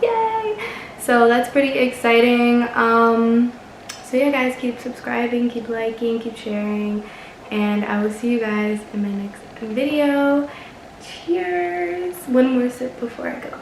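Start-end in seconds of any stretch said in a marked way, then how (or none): 0.0-0.6 a high bright little cheer; excited cheer. Real, not acted. Telling viewers about reaching five hundred subscribers, a high excited cheer. 11.0-12.1 high, light sign-off toast; cheery toast. Real, not acted. Signing off to viewers with a drink, a high cheery toast.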